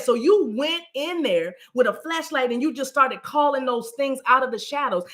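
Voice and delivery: ordinary talk, nothing marked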